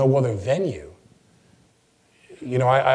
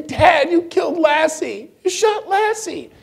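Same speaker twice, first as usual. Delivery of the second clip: put-on much higher, slow, wide-eyed child voice; shocked little girl; 5 dB louder, slower than usual. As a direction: play the horrified kid accusing her dad. Daughter voiced in retold sketch.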